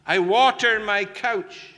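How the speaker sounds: lament. Acted. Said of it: "He says to God".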